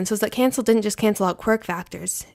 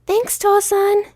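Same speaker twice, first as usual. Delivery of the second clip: put-on muffled child thanks; 12 semitones higher, 5 dB louder, slower than usual. A narrator's reading. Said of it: "his voice muffled by his shirt".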